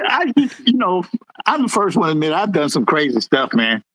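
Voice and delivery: ordinary talk, nothing marked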